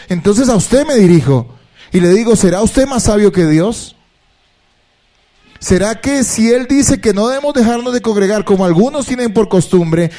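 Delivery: alto y fuerte, exhortando a la congregación; predicación intensa. Acted. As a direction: predica a todo pulmón, retando. Predica con fuerza. (el predicador exhorta a la iglesia, voz alta y enérgica)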